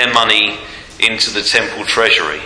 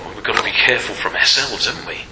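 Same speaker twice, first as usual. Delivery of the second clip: usual speaking voice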